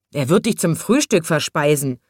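ordinary delivery

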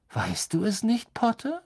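ordinary delivery

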